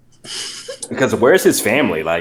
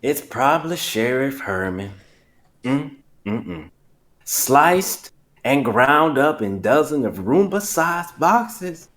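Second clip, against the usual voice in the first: put-on slow country accent, unhurried; Foghorn; 5 semitones higher, slower than usual. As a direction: stretch it out in a cartoon country drawl. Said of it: "I felt like I was being Foghorn".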